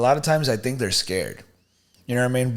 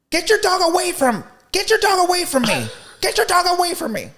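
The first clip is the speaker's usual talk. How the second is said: much higher mock Karen shrieking at a kid; shrill Karen yell. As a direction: screech it like an entitled lady telling a kid off. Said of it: "Like, yelling at him".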